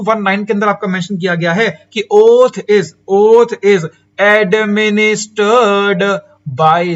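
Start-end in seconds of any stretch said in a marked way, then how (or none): 2.1-6.8 loud, very drawn-out words; slow, forceful dictation to a class; emphatic dictation. Real, not acted. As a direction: dictate it loudly, stretching each syllable. Teacher dictates provision wording.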